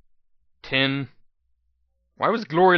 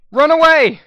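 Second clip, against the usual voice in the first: shouting; 14 semitones higher, 12 dB louder than usual. Acted. Fleeing the giant scorpion.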